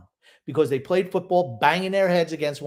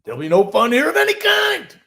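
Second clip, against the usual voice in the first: much higher and louder mock stern coach decree; stern Barry; 11 semitones higher, 6 dB louder than usual. Cohost mocks the old coach with a high, loud, stern decree. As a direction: bark out the no-fun rule like a killjoy coach. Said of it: "I know Barry wouldn't have liked that".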